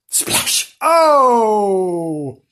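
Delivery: whooshed splash then cry; cartoon splash and yelp. Sound effect and cry.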